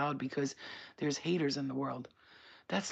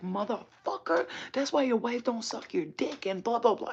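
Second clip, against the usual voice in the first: retold cursing out; 7 semitones higher, 4 dB louder than usual. Retelling cursing out the man at the rides, angry insult. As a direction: draw out the insult, then pitch it up. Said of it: "cursing him out".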